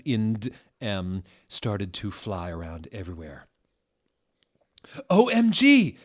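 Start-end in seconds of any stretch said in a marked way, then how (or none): 5.1-6.0 very high, very loud startled yelp; hammed-up squeal of alarm; shocked shriek. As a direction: jump out of your seat with it. Satan jumps up startled.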